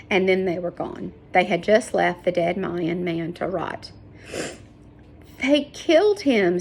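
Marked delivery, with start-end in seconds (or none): 5.4-6.6 high, pained outburst; anguished cry. A narrator's reading. Sarah crying out over the dead Mayan, high and pained. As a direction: let the grief break out. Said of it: "Sarah cried".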